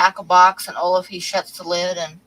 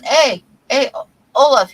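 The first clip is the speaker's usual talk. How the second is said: louder, higher call to a friend; 6 semitones higher, 6 dB louder than usual. Acted. Fisherman calls his best friend in the boat, calling out. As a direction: hail a buddy in a joke voice.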